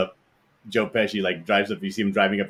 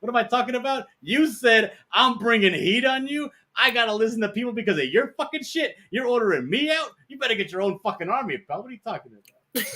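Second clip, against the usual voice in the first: much higher, hammed-up furious mobster; Joe Pesci rant; 13 semitones higher than usual. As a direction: shriek it like the angry little mobster. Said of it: "he's like".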